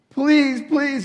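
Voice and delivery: mother cries out, begging Jesus to heal her daughter; desperate begging. Retold or imitated. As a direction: beg him to save your daughter. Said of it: "who's begging him".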